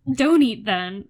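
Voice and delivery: retold angry outburst. Host retelling the male lead's retort, loud mock anger. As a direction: bark it like the sulking hero in the drama. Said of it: "He's like".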